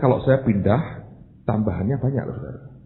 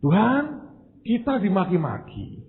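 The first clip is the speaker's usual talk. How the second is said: high-pitched, protesting plea to God; king's complaint to God; 7 semitones higher than usual. Acted. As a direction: plead indignantly with God, voice raised high. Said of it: "Dia ngomong gini kira-kira". (mengadu kepada Tuhan dengan nada tinggi)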